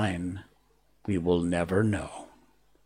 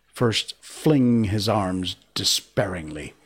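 ordinary delivery, nothing marked

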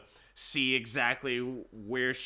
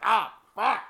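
high, loud yelp of pain as if just struck; pained yell; 10 semitones higher, 7 dB louder than usual. Retold or imitated. Man hit by golf club yelps, a loud pained shout.

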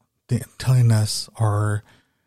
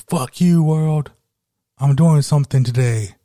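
loud, higher shouted challenge to the world; yelled defiance; 5 semitones higher, 6 dB louder than usual. Pretend. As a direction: yell it out at the world. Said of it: "go outside and fucking just yell and say".

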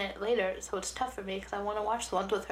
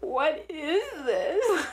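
baffled; 7 semitones higher, much slower than usual. Retold or imitated. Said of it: "I was just like".

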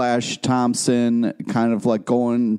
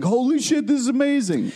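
mock hype; 10 semitones higher than usual. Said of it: "it wasn't like".

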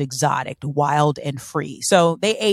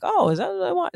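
airy high wonder; 12 semitones higher, 3 dB quieter than usual. Retold or imitated. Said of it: "being like".

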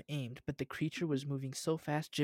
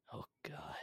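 horrified breathless whisper; 11 dB quieter than usual. Acted. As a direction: gasp it out in shock. Jim sees gruesome body.

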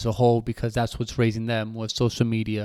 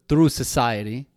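normal talking voice

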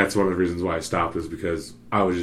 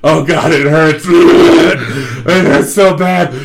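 much louder and higher, laughing through it; hammed-up hurt, cracking up; mock pained wail; 7 semitones higher, 10 dB louder, much slower than usual. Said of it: "I'm like".

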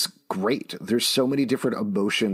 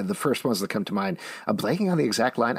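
ordinary talk, nothing marked

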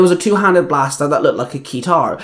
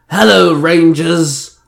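drawn-out, louder villain bark; barked greeting; 3 semitones higher, 5 dB louder, much slower than usual. A narrator's reading. Villain greets the Rangers in town, barking. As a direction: bark it out slowly and loudly. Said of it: "Banjan barked".

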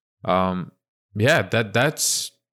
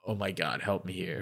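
mock despair under the breath; 2 semitones lower, 11 dB quieter than usual. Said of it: "He's like".

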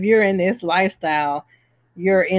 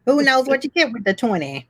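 gangster voice; 6 semitones higher than usual. Pretend. Keeps gangster voice for viewers.

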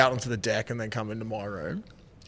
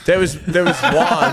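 laughing; 4 semitones higher, 6 dB louder than usual. Real, not acted. Laughing while starting his story.

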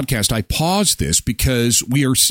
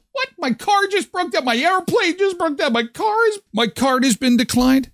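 higher, panicked disbelief in a put-on voice; shocked Trudeau. Trudeau panicking to the waiter, higher disbelieving squeal. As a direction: squeal it like a pampered man who can't believe it. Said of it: "it's like".

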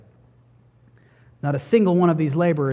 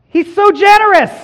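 much higher and louder, glowing with delight; delighted reaction; 10 dB louder than usual. Pretend. Preacher voicing a listener's delighted first reaction, excited. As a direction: gasp out the praise, thrilled. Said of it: "Is it".

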